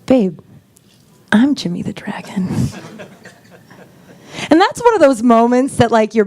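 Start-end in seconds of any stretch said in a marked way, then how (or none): 0.1-2.5 understated solemn confession; very serious. Retold or imitated. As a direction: say it with complete seriousness. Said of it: "very seriously".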